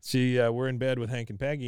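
normal talking voice

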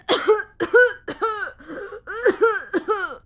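ragged racking cough; old miner choking on a coughing fit. A narrator's reading. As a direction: cough until it takes him down. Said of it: "He collapsed against the doorframe".

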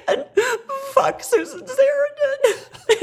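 very high, stretched-out sobby wail; hammed-up weeping through a curse; mock crying. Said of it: "then she started to cry".

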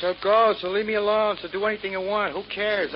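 high, loud, fed-up retort; exasperated outburst. Acted. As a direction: dismiss your brother in exasperation. Brothers quarrel, he tells him go.

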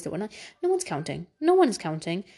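ordinary talk

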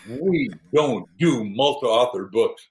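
dismissive. Retold or imitated. Voices dismissive bestseller-list clerk.

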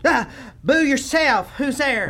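high, loud startled cry; startled yelp. Acted. Startled senator squeals at the ghost, high and loud. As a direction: jolt awake and squeal at the ghost.

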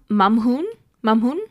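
Mamhun with a nasal a and rising end; Arabic word. Shown as a demonstration. Reading an email, sounding out Mamhun. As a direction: try out Mamhun carefully. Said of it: "fun Arabic fact number two".

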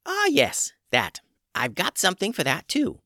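put-on high, calm old witch; a witch healer in charge. A narrator's reading. Old witch Tubes speaks.

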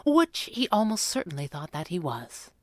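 usual speaking voice

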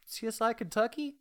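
playful high rising question; mock questioning pitch. Shown as a demonstration. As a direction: toss off "C S I Kentucky?" as a high, rising, quizzical quip. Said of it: "it would even be better if he was just like".

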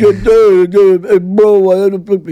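mock them as loud, brainless bros; hammed-up imitation of a dim wannabe mobster; moronic wannabe gangster. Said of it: "they talk like morons".

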